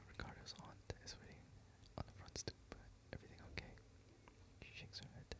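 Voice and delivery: soft unvoiced whisper, reading the story. A narrator's reading. Reading the novel aloud to the mic, in a steady whisper. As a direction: breathe the narration out without voice.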